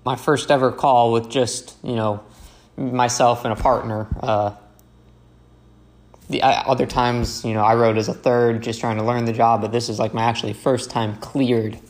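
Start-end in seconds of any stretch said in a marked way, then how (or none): none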